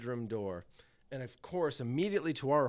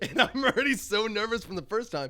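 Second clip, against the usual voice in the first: laughing; 8 semitones higher, 7 dB louder than usual. Real, not acted. Laughing while telling.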